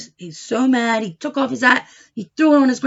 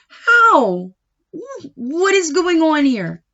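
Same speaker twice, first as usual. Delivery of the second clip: surprised peddler; 6 semitones higher, slower than usual. Acted.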